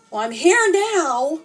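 indignant sarcastic retort; annoyed. Acted. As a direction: make them hear you're fed up. Ghost annoyed at being ignored.

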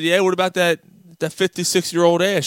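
loud, high comeback in a joke; acted-out needling retort; taunting husband. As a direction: jab back as the unimpressed husband.

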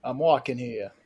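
low, soft mock New York accent. Co-host quoting after another can't do New York, New York accent. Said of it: "I can't do New York".

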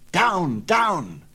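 high, urgent shouted commands; crying out. A narrator's reading. Phaethon shouting down at the runaway horses. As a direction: keep yelling at the horses to go down. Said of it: "he cried".

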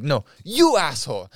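"you" thrown back with emphasis; stress on YOU. Retold or imitated. Phone guy returning the insult, "you" strongly stressed. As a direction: lean hard on "you" as a comeback.

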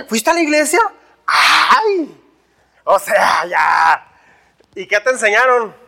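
exaggerated incredulous question with a drawn-out wail; mocking astonishment. People needle someone who went to church, mock-amazed. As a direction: tease with hammed-up amazement.

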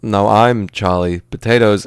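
slow, halting rebuttal; mock-dramatic, drawn-out counterclaim; Christopher Walken. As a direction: insist on the name with odd pauses. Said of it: "Walken's gonna be like".

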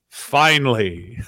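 low, drawn-out word of relief; slow mock relief after waiting; imagined relieved listener. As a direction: drop low and stretch it out slowly. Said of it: "This is how I picture someone listening".

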